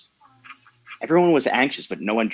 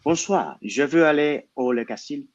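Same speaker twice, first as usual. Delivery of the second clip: higher-pitched French delivery; speaking French; 5 semitones higher than usual. A narrator's reading. Daniel addresses an unseen figure, speaking French. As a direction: lift the pitch and put on French. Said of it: "He then spoke in French".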